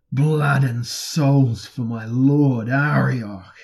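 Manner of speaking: very loud, long-drawn war cry; slow, ringing invocation at full voice; screamed battle cry. A narrator's reading. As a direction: bellow the offering slowly to your god. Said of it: "He was screaming the name of Arioch".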